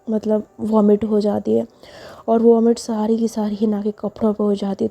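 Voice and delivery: usual speaking voice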